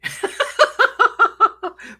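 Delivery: high burst of laughter; real laugh. Real, not acted. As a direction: break into a bright, high laugh. Laughing at her own joke.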